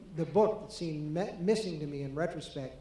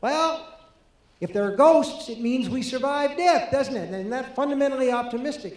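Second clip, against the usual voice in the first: higher, louder mock Kubrick; Kubrick quoted; 8 semitones higher, 7 dB louder than usual. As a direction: imitate him lifting his voice, musing. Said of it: "And he said".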